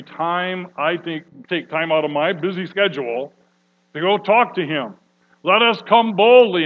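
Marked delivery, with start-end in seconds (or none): 5.4-6.6 "Let us come boldly" much louder and higher; bold call. Acted. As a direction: proclaim "boldly" out loud. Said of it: "that word boldly means to pour everything out".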